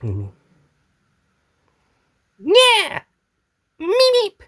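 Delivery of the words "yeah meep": loud, very high squeal; Meep character voice. Acted. Practising the Meep's cries.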